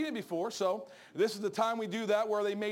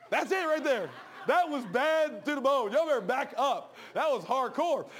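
boastful; 4 semitones higher, 5 dB louder, slower than usual. Acted. Bragging about his BMX trick.

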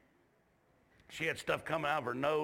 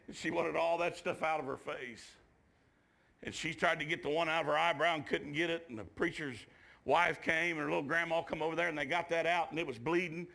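ordinary talk

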